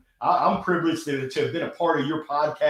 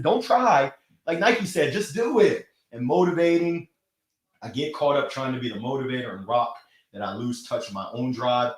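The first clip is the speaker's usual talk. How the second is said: ordinary delivery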